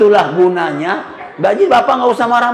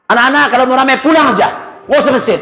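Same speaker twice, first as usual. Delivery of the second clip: harsh imam scolding; 5 semitones higher, faster than usual. Retold or imitated. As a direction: snap at the noisy kids. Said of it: "kalau ngomong".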